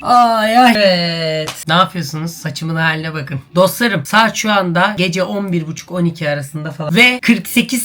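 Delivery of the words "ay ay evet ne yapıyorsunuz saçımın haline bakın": ordinary talk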